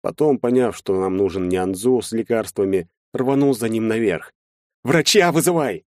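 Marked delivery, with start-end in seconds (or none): 4.8-5.8 high, raised urgent command; sharp emergency yell; barked order. A narrator's reading. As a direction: bark it at a sister. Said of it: "рявкнул я на сестру".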